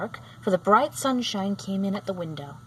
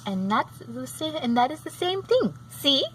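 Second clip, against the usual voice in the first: put-on laughing old woman; 6 semitones higher than usual. A narrator's reading. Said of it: "with a laugh".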